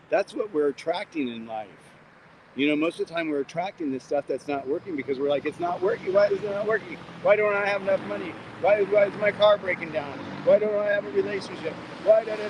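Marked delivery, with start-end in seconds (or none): 5.5-12.5 high, exasperated whine of a frustrated person; whiny complaining. Retold or imitated. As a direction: mimic someone moaning about everything going wrong. Whining list of complaints, pitched up.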